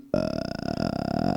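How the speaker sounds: very low croaked uh; the stupid kid sound, deep and gruff; low guttural kid noise; childish low grunt. Retold or imitated. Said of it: "We all went".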